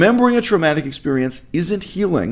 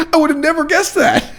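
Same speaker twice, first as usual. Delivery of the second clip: high-pitched, laughing fake astonishment; sarcastic mock surprise; 13 semitones higher than usual. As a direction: squeal it in pretend disbelief. Sarcastic feigned surprise at a review.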